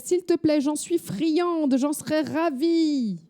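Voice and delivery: put-on high, excited old lady begging; squealing with delight; eager granny. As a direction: beg like a thrilled granny.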